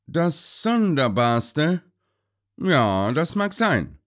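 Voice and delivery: langsam, grübelnd gedehnt; nachdenklich. A narrator's reading. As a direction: lass das Wort nachdenklich nachklingen. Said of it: "sagte Holmes nachdenklich". (Holmes spricht nachdenklich zu Sir Henry)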